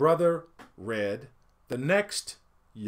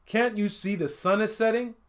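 mild shout; 7 semitones higher than usual. A narrator's reading. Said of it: "shouted the sixth son".

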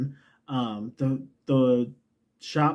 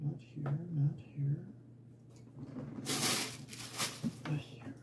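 whisper; 15 dB quieter than usual. Real, not acted. Muttering while searching for yarn.

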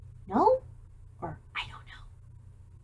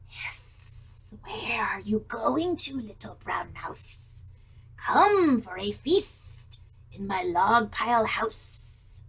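hissing snake; 4 semitones lower, slower than usual. Acted. Snake invites mouse to a feast, whispering. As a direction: lure him in like a sly snake.

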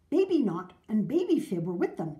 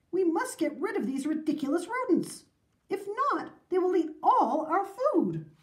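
high, emphatic public plea; rally the townsfolk with raised pitch; indignant mayor; 8 semitones higher than usual. A narrator's reading. Mayor urging action at town meeting, emphatic indignation.

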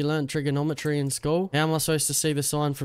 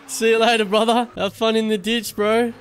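higher, louder taunting send-off; mocking farewell; 8 semitones higher, 7 dB louder than usual. Real, not acted. Gamer taunting the player he crashed into the ditch, loud mocking goodbye. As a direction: wave them off with a sneer.

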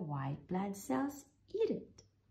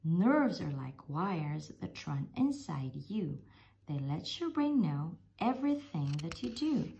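ordinary delivery, nothing marked

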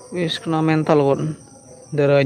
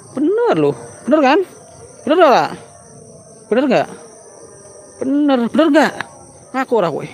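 high, pushy repeated demands; taunting interrogation; 7 semitones higher than usual. Acted. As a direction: badger the ghost to confess. Interrogating a spirit, high, loud.

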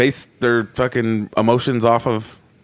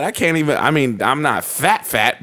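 normal talking voice

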